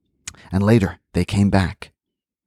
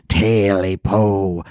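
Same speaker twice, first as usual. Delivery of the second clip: slow eerie creature growl; much slower than usual. Acted. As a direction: growl it out slowly like a beast. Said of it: "it growled".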